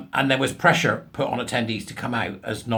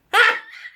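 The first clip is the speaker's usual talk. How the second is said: a sudden high burst of laughter; 5 dB louder than usual. Real, not acted. Laughing at the daft bloke's logic, high. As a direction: crack up at the punchline.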